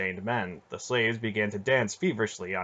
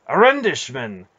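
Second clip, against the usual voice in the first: loud, higher shocked cry; exclaiming; 4 semitones higher, 10 dB louder than usual. A narrator's reading. Knight shocked to see his countryman enslaved, loud exclamation. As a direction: blurt it out in shock. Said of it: "the knight exclaimed".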